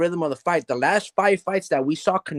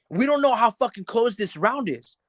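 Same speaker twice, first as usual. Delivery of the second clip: higher, urgent trainer's voice; urgent corner trainer; 6 semitones higher than usual. Retold or imitated. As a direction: push the fighter between rounds with urgency. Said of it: "telling Bud Crawford".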